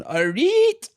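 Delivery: loud, high-pitched Geordie caricature; mock Geordie accent. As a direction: belt out a hammed-up Geordie greeting. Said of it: "We don't walk about going".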